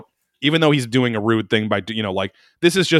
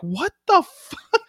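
high, drawn-out incredulous cry; retold exasperated disbelief; much slower than usual. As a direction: stretch it out in mock disbelief. Retold exasperation, throwing up hands.

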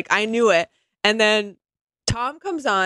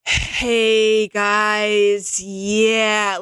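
mopey sigh of victimhood; much slower than usual. Retold or imitated. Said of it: "His tone is like".

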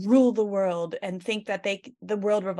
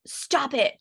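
raised, louder-pitched imitation of scolding; higher mock-stern command; stern mock yell; 7 semitones higher, 3 dB louder than usual. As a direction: scold a kid from upstairs, play-acted. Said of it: "this very stern".

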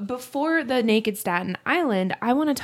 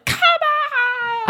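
much higher mock yell; Tom Hanks yelling; 3 dB louder than usual. Imitating Tom Hanks yelling in Turner and Hooch. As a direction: bark it out, high and big. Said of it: "I think of him with Turner and Hooch. Like, yelling".

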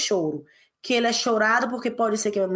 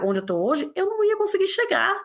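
usual speaking voice

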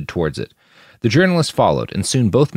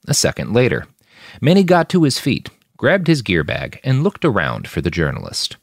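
ordinary delivery, nothing marked

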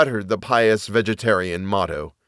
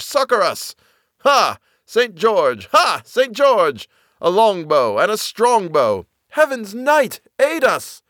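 high, ringing calls for aid; shouted battle cries; 9 semitones higher, 3 dB louder than usual. A narrator's reading. Phantom bowmen calling on Saint George, shouting. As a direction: call out to the saint like an army in battle. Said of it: "thousands shouting".

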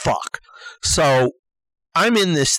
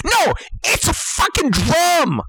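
high-pitched exasperated correction; indignant comic outburst; emphatic rebuttal; 9 semitones higher than usual. Acted. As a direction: cut the objection off, voice jumping up. Shooting down imagined objection.

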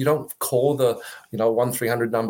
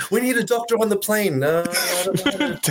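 playful mock plane emergency call; high, laughing mock emergency call; joking announcer; 9 semitones higher than usual. Jokey doctor-on-the-plane bit.

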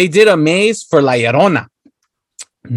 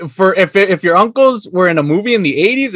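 normal talking voice

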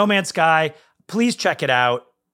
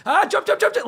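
frantic shouting. Retold or imitated. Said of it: "You're like".

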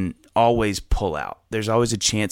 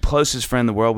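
usual speaking voice

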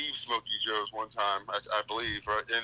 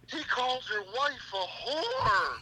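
mock indignant plea; 8 semitones higher, 3 dB louder, slower than usual. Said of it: "I go".

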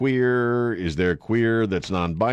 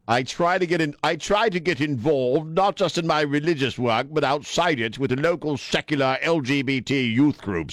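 higher-pitched put-on British accent; wrong British accent; 6 semitones higher than usual. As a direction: read the quote in a hammed-up British accent. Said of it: "I did the wrong accent".

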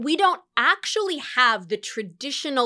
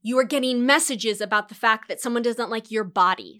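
ordinary talk, nothing marked

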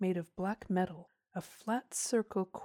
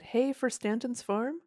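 higher, lightly teasing question; joking; 5 semitones higher, 4 dB louder than usual. A narrator's reading. Farmer joking about the boys' mother's farm, teasing. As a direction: toss it out as a friendly joke. It was his joke.

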